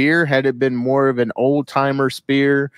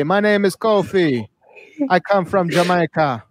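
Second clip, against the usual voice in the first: higher, hammed-up fake Jamaican accent; mock Jamaican accent; 7 semitones higher than usual. Host mocking a wrestling gimmick in a higher fake Jamaican accent. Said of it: "told Kofi Kingston to say".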